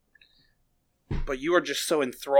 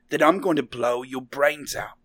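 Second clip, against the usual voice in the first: ordinary delivery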